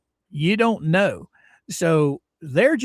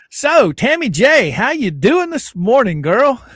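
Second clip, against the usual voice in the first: louder, higher friendly hello; excited greeting; 6 semitones higher, 7 dB louder than usual. Real, not acted. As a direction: greet her with gusto. Greeting viewer.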